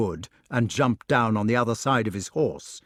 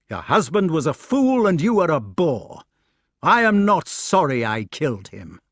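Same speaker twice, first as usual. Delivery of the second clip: raised, louder scolding voice; angry rebuke; 5 semitones higher, 5 dB louder than usual. A narrator's reading. Knight to the howling widow of his attacker, rebuking angrily. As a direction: scold her hard.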